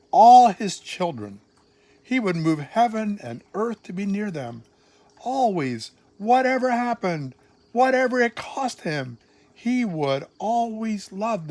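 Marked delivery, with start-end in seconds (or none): none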